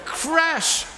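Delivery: high, loud sound-effect shout; crashing wave. Shown as a demonstration. Leader shows the group their wave sound, shouted crash. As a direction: burst out like a breaking wave. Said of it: "Can we try that?".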